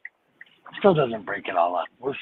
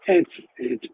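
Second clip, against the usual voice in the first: lost voice; 7 dB louder than usual. Real, not acted. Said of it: "I have no voice".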